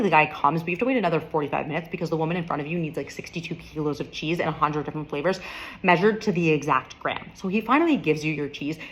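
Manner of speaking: ordinary talk, nothing marked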